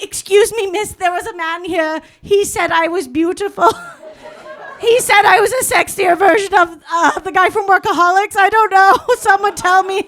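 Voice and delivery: high, loud, hammed-up pleading; pleading searcher. Woman at McDonald's trying to find this man, pleading.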